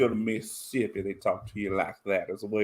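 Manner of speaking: Mississippi accent. Shown as a demonstration. Co-host showing how Mississippi talks to you, low Mississippi accent. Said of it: "Unless you're from Mississippi".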